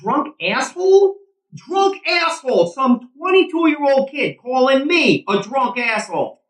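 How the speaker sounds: high, indignant outburst; outraged. Acted. Eddie fuming at being called a drunk asshole, high outraged shouting. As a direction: repeat the insult in disbelief, voice climbing. Furious after ump's insult.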